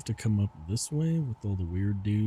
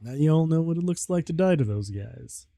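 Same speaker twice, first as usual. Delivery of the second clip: laughing while talking; giggly, amused delivery; 6 semitones higher, 5 dB louder than usual. Real, not acted. Laughing after dying in game.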